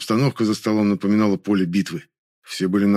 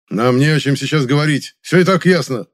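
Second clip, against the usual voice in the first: loud, raised, impatient refusal; irritated; 6 semitones higher, 5 dB louder than usual. A narrator's reading. Ivanov refusing to talk, irritated. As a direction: brush him off with annoyance. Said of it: "раздражённо ответил он".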